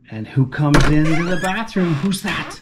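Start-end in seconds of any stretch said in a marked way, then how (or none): none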